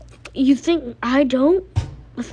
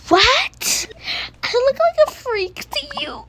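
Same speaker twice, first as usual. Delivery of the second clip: mock hurt cry; 12 semitones higher, 3 dB louder than usual. Girl offended, fake crying.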